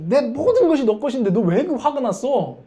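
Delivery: loud, high-pitched frustrated plea; exasperated father. Acted. Father questioning his angry elder son, exasperated. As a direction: press the question loudly, at wit's end. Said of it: "아버지가 답답해서".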